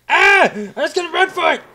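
high, loud panicked yelp; yelp, then blurt it out in alarm; startled yell. Real, not acted. Player is caught off guard in the game, startled yell. Jump scare in horror game.

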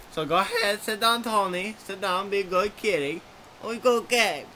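bright, high little dog voice; lightly voiced cheerful dog. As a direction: greet them like a perky chihuahua. Chihuahua gives love advice.

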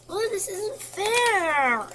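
high, loud and slow, a drawn-out whine; whiny complaint. Real, not acted. Player frustrated by the puzzle, high loud whine.

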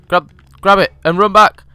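ordinary talk, nothing marked

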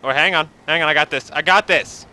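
ordinary talk, nothing marked

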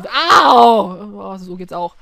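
usual speaking voice

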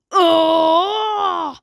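sharp cry of pain from a hurt zombie. Pretend. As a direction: yelp as if struck in the eye. Zombie hit in the eye.

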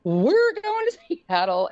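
mother at the plane, high and elated; overjoyed. Retold or imitated. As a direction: let the joy ring out slowly. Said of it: "I'm like".

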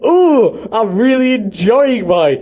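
high, slow, put-on delight; false enthusiasm. Host imagining forced product placement, falsely enthusiastic and high. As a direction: gush fakely like a product plug. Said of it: "Can you imagine how false".

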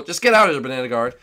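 ordinary talk, nothing marked

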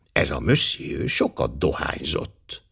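soft and slow, almost apologising; hushed apologetic remark, taken slowly; quiet, almost apologetic. Acted. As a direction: murmur it gently as if excusing yourself. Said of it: "halkan, szinte mentegetőzve jegyezte meg".

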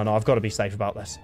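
ordinary talk

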